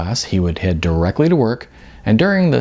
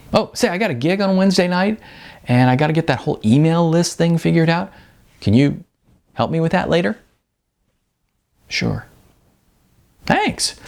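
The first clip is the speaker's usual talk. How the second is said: put-on higher, upbeat young guy asking a favour; a friendly young man asking for help; eager Sean; 7 semitones higher than usual. Sean asks Icon for help.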